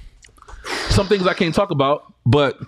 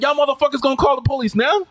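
yelling at police; 8 semitones higher than usual. Retold or imitated. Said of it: "I'm yelling and shit at the police".

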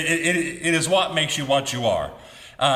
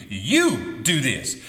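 long, punched "You" that swoops up, the rest falling away; stress on You; 4 semitones lower, much slower than usual. Shown as a demonstration. Preacher showing emphasis on one person, "You" stretched and punched. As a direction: lean hard and slow on "You". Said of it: "with that emphasis on the word you".

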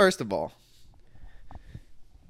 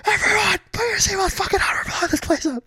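very high, frantic intercom yell; shrill frantic announcement. Pretend. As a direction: shriek it over the PA. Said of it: "make it sound even worse".

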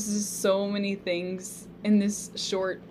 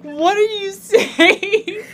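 laughing disbelief; 13 semitones higher, 11 dB louder, slower than usual. Retold or imitated. Reacting in disbelief to friend.

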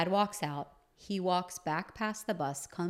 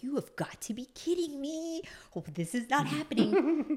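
pitched way up, stunned disbelief; high disbelief; 10 semitones higher than usual. Retold or imitated. Teen daughter watching dad storm the bus, high stunned disbelief. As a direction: squeak out your shock. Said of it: "I'm watching this like".

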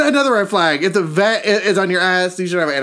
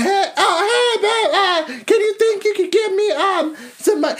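high, whiny put-on voice asking for money; mock begging friend; 8 semitones higher than usual. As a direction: wheedle sweetly, pitched way up. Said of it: "I be like".

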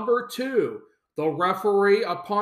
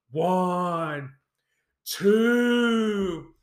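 mock slow count; 2 semitones lower than usual. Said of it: "they go so slow".

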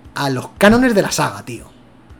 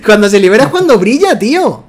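words carried on a laugh, louder; 4 semitones higher, 5 dB louder than usual. Real, not acted. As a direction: laugh through the praise. Laughing while praising.

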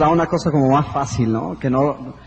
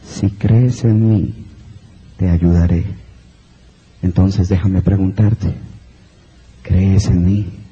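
much lower, slow, booming godlike voice; voice from above; 11 semitones lower, 4 dB louder, slower than usual. Acted. Voice from above answers the hanging man, deep and slow. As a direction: speak down from the heavens, deep and deliberate. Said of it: "y la voz dice".

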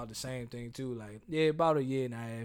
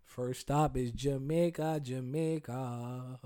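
slow, dreamy drawl; slower than usual. Real, not acted. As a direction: stretch out Jamaica slowly. Dreamy destination call.

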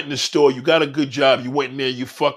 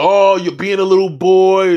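louder, mocking put-on voice; mocking roast; 2 semitones higher, 8 dB louder than usual. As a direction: tease him like a little boy. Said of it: "they're gonna try to roast me".

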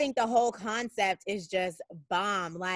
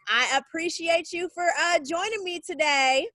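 bright thanks; 7 semitones higher, 3 dB louder than usual. Real, not acted. Host thanks guest warmly.

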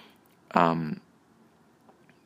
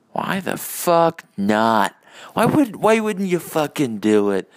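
playful rant; 7 semitones higher, 4 dB louder, slower than usual. Pretend. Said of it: "Having a little fun there".

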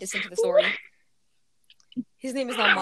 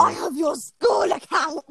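excited shout; 4 semitones higher, slower than usual. Real, not acted. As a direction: crow over the discovery. Caught friend's school account.

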